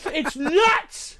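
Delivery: high, thrilled doofus impression; mock a guy marveling at gore; hyped-up guy. Doofus pushing gory photo on passenger, hyped up. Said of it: "he was so hyped".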